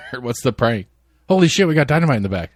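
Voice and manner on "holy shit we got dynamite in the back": mock alarm.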